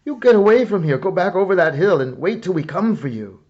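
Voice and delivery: loud, high urgent shout; cried out. A narrator's reading. Scipio shouting at Dan to flee the battle. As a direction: yell at the boy to get away. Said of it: "he cried out".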